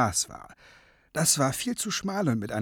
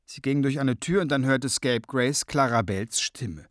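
usual speaking voice

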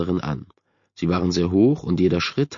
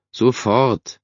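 higher, mildly irritated call; slightly annoyed call; 4 semitones higher, 4 dB louder than usual. A narrator's reading. Son calling back to his mother, slightly annoyed. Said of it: "rief Brad leicht genervt".

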